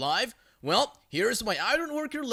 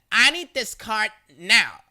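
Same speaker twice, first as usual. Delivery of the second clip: demanding; 3 semitones higher, 8 dB louder than usual. Retold or imitated. Crazy lady demanding the cart.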